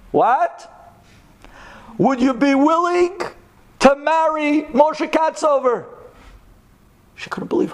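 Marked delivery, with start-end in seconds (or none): 0.1-5.8 high, raised, drawn-out question; ringing high-pitched proposal question; insistent repeat. Acted. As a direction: ask it big and slow so it sinks in. Rabbi repeats the proposal louder.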